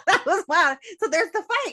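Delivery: high-pitched, amused, talking through laughter; real laughing speech. Real, not acted. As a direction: laugh your way through the words. Laughing at her own retort.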